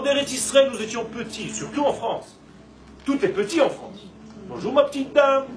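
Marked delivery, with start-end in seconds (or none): 4.5-5.4 higher, louder mock-cutesy pleasantries; mocking small talk. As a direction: tease the habit of calling everything little, pitched up. Mocks French small talk, everything petit. (imitation moqueuse, voix haut perchée)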